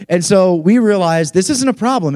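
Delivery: ordinary talk, nothing marked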